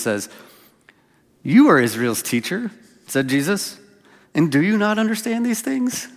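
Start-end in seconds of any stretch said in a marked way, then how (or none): 1.4-6.1 with a wink. Retold or imitated. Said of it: "with a bit of a wink".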